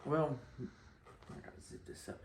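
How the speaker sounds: whispered and slow; secret whisper. Acted. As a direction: lean in like a confessional. Sneaky confessional opener.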